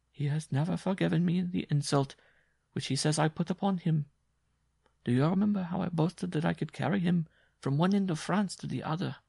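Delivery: a worn-out woman telling her hardship; Jean's voice. Acted.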